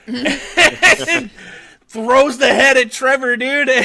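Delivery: loud, high, laughing retelling. Real, not acted. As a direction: tell it through real laughter. Cracking up while retelling the scene.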